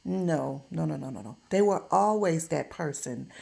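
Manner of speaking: ordinary talk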